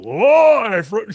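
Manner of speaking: hammed-up Vince, high and loud; mock Vince announcement. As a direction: launch it like a big live intro. Imitating Vince delivering the line.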